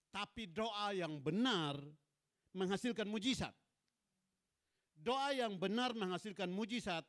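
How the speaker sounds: loud, forceful sermon declaration; emphatic preaching. Real, not acted. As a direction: proclaim the key statement to the congregation. Preaches the main statement forcefully. (khotbah lantang penuh penekanan)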